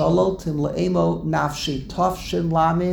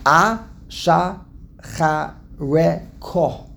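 Ashachareko in very slow separate syllables, A-sha-cha-re-ko; drawn-out, syllable-by-syllable Ashachareko; much slower than usual. Shown as a demonstration. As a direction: break Ashachareko up with a clear pause after every syllable. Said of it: "That's a hard word to say".

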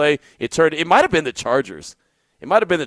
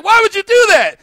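much higher, louder mock yell, half laughing; incredulous shout; 5 dB louder than usual. To the soaked friend by the pool, an incredulous laughing yell.

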